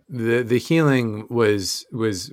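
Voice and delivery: normal talking voice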